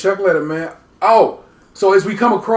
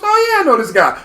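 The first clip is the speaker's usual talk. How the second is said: high mock reply; 11 semitones higher, 3 dB louder than usual. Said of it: "And he goes".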